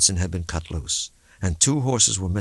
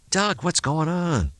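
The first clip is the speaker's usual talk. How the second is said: bewildered; 10 semitones higher, slower than usual. Acted.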